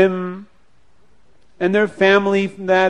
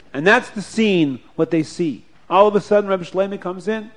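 ordinary delivery

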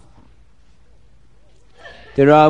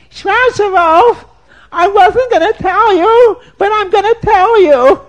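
shrill woodcutter's wife; 8 dB louder than usual. Acted. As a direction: shoo the stranger off in a high, loud voice. Woman voice in told story.

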